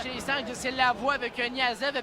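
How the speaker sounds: normal talking voice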